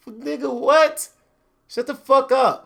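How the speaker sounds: reacting to a Facebook quote; exasperated outburst. Real, not acted. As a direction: snap back at the quote. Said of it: "reacting to quote".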